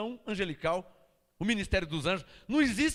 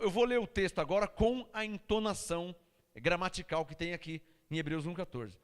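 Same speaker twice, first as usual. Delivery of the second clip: ordinary talk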